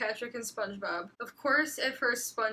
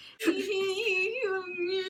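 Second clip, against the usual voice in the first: a high laugh breaking through; high cracking laugh; 9 semitones higher than usual. Real, not acted. Reader laughing at her own fanfic, high. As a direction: crack up mid-line.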